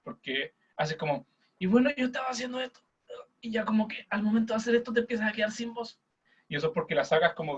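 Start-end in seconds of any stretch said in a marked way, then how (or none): 1.6-6.0 high, pinched in the throat, running out of air. Shown as a demonstration. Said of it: "sacas como la voz de la garganta".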